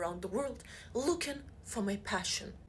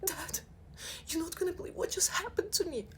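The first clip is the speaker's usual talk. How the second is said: mock panic, much higher; whispered panic; 8 semitones higher, 4 dB quieter than usual. Daughter calls dad from bathroom, higher and panicky. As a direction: whine it to dad in alarm. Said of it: "I start whispering".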